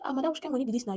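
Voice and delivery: mild retold protest. Driver to passenger over strange money, mildly protesting. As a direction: question her like a baffled driver.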